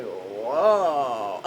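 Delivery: woozy amazement; dazed whoa. Retold or imitated. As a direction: let it drift like you're drugged. Drugged reaction after surgery.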